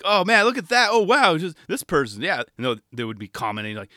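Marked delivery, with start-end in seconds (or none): 0.0-2.2 higher, louder eager oohing; higher louder mock excitement; excited commentators. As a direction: gasp at the trick like a fan.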